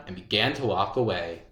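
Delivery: plain reading voice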